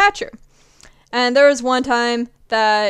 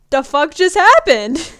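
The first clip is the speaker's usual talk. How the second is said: baffled disbelief; 5 semitones higher, 6 dB louder, slower than usual. Retold or imitated. Walking back to dugout, baffled.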